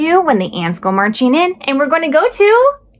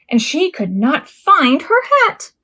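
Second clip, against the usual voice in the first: plain reading voice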